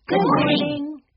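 high, cheery greeting; pleasant call. A narrator's reading. Children greet the concession worker, calling pleasantly in high voices. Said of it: "the Aldens called pleasantly".

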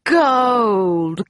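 loud, drawn-out pirate cry; exultant pirate. A narrator's reading. Captain Bones catches the medallion, crying triumphantly.

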